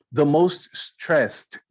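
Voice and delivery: the most stressed, very slow and clear; slow model phrase. Shown as a demonstration. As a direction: draw out each word for the class. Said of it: "termina com E D". (bem devagar, como exemplo)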